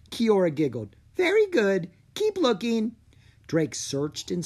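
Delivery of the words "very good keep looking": bright, high little fairy. A narrator's reading. Fairy Kiora praising Drake, high and sweet.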